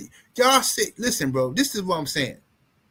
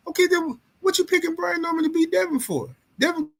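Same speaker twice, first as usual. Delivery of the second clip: much higher-pitched, incredulous question; incredulous challenge; 10 semitones higher than usual. Real, not acted. Host challenges fans who picked Norman, incredulous. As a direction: press them in a high disbelieving voice.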